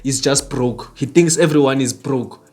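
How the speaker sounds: low, lightly voiced scoffing onlooker; mock critic. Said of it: "don't listen to me speaking like this and think".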